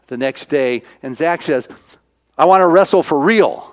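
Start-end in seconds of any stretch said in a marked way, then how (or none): 2.4-3.7 loud, higher acted declaration; emphatic declaration. Boy telling his trainer at dinner, loud determined declaration.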